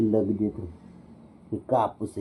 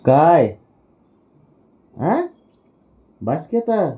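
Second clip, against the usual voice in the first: playful loud calls; 8 semitones higher, 9 dB louder, slower than usual. Real, not acted.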